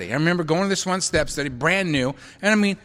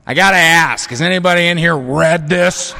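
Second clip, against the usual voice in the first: put-on much louder booming old-timer; booming question; 7 dB louder than usual. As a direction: boom it across the room. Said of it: "he goes".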